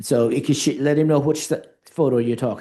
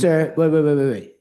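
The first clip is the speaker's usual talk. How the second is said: firm interruption; 3 semitones higher, 4 dB louder than usual. Real, not acted. Chair cuts off witness.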